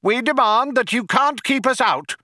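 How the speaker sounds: high, insistent bawling; bawled demand. A narrator's reading. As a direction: bawl it high, demanding your way. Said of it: "bawled the younger one".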